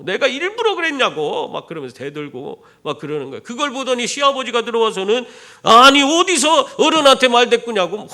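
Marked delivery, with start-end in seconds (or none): 0.1-1.5 high-pitched mock protest; defiant back-talk. Daughter-in-law talks back to mother-in-law, mock defiant. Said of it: "시어머니한테 막 대드는 거예요". 5.6-8.0 loud, high-pitched mock rebuke; scolding father-in-law. Father-in-law rebukes her for talking back, loudly indignant. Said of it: "시아버지가 또 꾸짖네".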